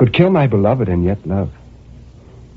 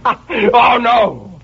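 laughing; 12 semitones higher than usual. Acted. Laugh into denial.

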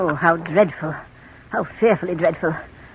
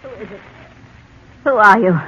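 a frightened call into the dark; sudden fear, louder on the second question; old woman startled by a figure; 3 semitones higher, 5 dB louder, faster than usual. Acted. Startled invalid hears intruder.

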